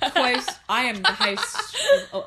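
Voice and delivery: laughing through the words, high and loud; cracking up while fumbling the intro. Real, not acted. Host laughing at her own slip, cracking up.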